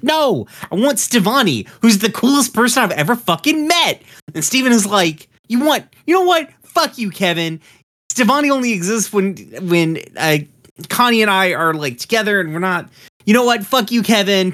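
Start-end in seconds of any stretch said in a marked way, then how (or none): 0.0-4.0 high-pitched, excited retold gush; mock gushing. Kevin tells Steven he wants Stevonnie, mock gushing. 5.5-14.5 high-pitched flustered retort; mock indignant. Steven tells Kevin off at the party invite, mock indignant.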